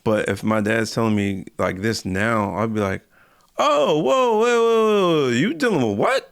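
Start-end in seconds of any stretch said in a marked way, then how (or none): none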